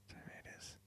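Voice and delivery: whisper. Pretend. Joking about social distancing.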